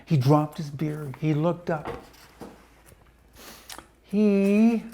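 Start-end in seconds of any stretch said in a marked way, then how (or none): 4.0-4.8 shocked. Acted. Gasping Mister Twit.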